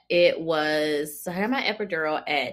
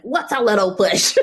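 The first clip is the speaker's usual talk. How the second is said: laughing disbelief; 4 semitones higher, 5 dB louder than usual. Retold or imitated. Incredulous quote with laugh.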